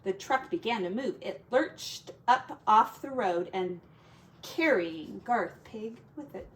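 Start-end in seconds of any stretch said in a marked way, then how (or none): none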